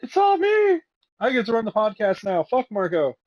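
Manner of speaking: crowing with playful glee; gleeful triumph. Pretend. Gloating while cohost drops out.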